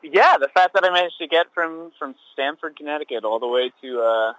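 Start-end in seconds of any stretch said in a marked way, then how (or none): none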